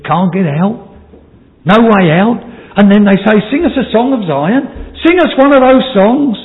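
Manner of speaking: forceful preaching. Acted. Preacher voices captors demanding a song of Zion, forceful preaching. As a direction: press it home, climbing in pitch.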